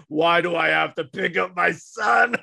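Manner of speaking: slow mock shout in a big dad's voice; slow shouted big-dad voice at the camp counselor; imitating the big dad. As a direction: ham up the intimidating father demanding answers. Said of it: "And it has this deep voice".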